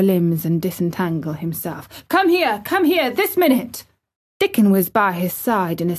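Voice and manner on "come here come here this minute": high, insistent repeated command; urgent cries, pitched way up; shouted. A narrator's reading. Boy tearing off rugs calls Dickon, shouting. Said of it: "he shouted to Dickon".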